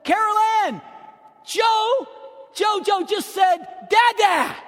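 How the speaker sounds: high, loud excited shout through the house; proud father yelling the news. Retold or imitated. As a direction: call out thrilled to the whole house. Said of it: "I yelled through the ho-, my home".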